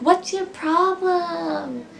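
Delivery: slow, drawn-out sneer; mocking snotty fan. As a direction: mimic a rude fan. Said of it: "they're like".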